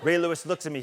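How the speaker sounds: normal talking voice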